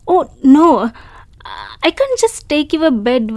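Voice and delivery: loud flustered refusal; loud, embarrassed no to his offer; flustered protest. Acted. Protesting taking the host's bed.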